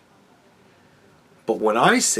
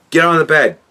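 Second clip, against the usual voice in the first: higher, loud order to a dog; mock stern command; 4 semitones higher, 9 dB louder than usual. Streamer ordering his dog onto the bed, mock stern command. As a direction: boss the dog around, loudly.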